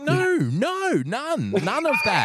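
no, no, none of it, pushed up high; emphatic no. Real, not acted.

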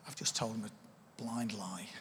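low, quiet, slow admission to oneself; dawning realisation. Acted. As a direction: let it sink in, quietly and slowly. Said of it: "and you realise".

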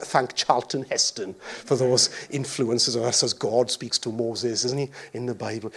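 usual speaking voice

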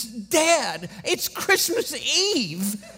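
put-on high, whiny little girl's tearful plea; a child's high tearful whine about Christmas Eve; imitating crying daughter. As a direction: play the upset small daughter near tears. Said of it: "she just starts crying".

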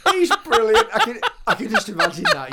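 high laughing delight. Real, not acted. Friend laughing at the Irish visitor story. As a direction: laugh through the words.

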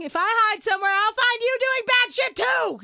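loud, high mock outrage; indignant rant. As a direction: yell back at the cops. Mock rant at hiding cops.

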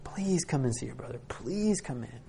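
soft, hushed begging; gently imploring father; pleading. Acted. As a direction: plead quietly with your son to come in. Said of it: "begging and pleading for his son".

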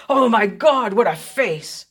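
loud gasp of horror, falling away; mild horrified exclamation. A narrator's reading. Colonel recoiling from a face on the yacht, mildly horrified. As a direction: exclaim in horror, short of a scream. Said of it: "with a scream of horror".

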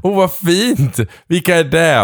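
high, bright fake enthusiasm; mock delighted. Playfully answering boyfriend's queue boast, high and mock-delighted. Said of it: "Jag bara".

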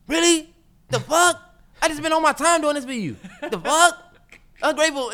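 high-pitched, incredulous outrage; mock indignation. Boyfriend answers ungrateful girlfriend after cleaning her room, indignant.

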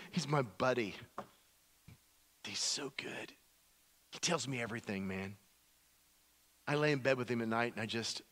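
low, soft, intimate confiding; Jesus. Acted. Jesus talking about his dad as his pal, hushed and fond. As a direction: confide fondly, dropping low and quiet. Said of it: "You know what Jesus says?".

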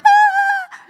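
very high frightened shriek; high, loud, short child's scream of fright; child's startled scream. Retold or imitated. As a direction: shriek like a startled little kid. Child frightened by parent's yell.

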